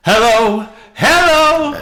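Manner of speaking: high, rising calls, held long; mimicking an excited fan hailing someone; imitated fan's call. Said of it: "next thing he says is".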